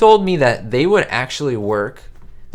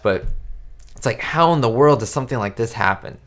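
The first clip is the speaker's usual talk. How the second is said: ordinary talk, nothing marked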